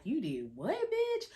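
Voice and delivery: bitch leaps way up in mock outrage; shocked friend. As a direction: imitate a friend's disbelief. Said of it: "they like".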